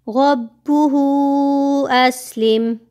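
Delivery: slow chanted recitation, heavy qaf; stretched tajweed chant; Quranic recitation. Shown as a demonstration. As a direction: recite slowly and melodically. Recites verse.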